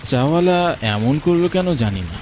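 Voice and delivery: usual speaking voice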